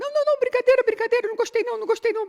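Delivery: put-on very high, hurried child's voice; panicked child. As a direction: back down fast like a scared little boy. Boy panics as brother approaches.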